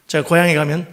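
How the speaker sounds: usual speaking voice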